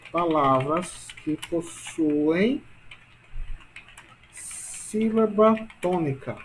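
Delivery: drawn-out words with long pauses; slow dictation. Shown as a demonstration. Teacher writing out definition for class, slow dictation. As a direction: dictate slowly, stretching the ends of phrases.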